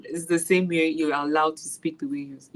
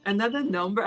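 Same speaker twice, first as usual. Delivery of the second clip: high, puzzled student voice; student squeaks up in disbelief; 9 semitones higher than usual. Retold or imitated. Teacher imitates a confused student questioning her eighty, high and puzzled. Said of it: "And he goes".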